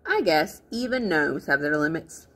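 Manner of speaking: ordinary delivery, nothing marked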